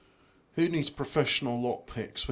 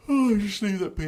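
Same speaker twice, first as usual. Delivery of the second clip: high, louder, put-on repeat; playful high voice; 9 semitones higher, 6 dB louder than usual. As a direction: lift it high and loud in mock lightness. Playful repeat of the same words.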